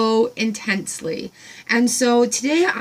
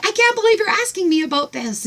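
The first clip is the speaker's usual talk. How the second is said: much higher, bright chirpy reply; farm woman quoted; 9 semitones higher than usual. Retold or imitated. Voicing the farm woman answering her, high and chirpy. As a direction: chirp it back delighted. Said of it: "she's like".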